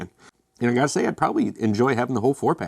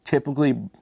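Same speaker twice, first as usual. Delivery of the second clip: usual speaking voice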